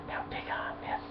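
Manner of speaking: breathy whispered quote, barely voiced; imitating Spider-Man in a mocking whisper. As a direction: whisper it like a cheesy movie line. Mocking Spider-Man's dance line.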